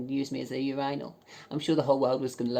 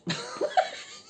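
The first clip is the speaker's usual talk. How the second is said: squealing laugh. Real, not acted. Laughing at her own joke.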